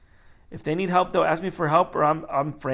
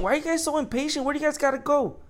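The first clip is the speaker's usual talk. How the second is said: much higher, exasperated questioning; impatient; 11 semitones higher, faster than usual. Acted. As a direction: plead with impatient people in a high voice. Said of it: "everybody's impatient".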